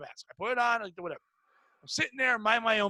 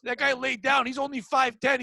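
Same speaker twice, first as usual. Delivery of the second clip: ordinary delivery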